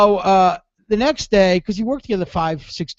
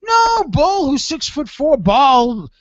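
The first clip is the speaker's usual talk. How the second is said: a pitched-up, eager persuader; coaxing higher voice; 8 semitones higher than usual. Retold or imitated. John coaxing Dominic that Bull will laugh, higher eager voice. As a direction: talk him into it, high and insistent.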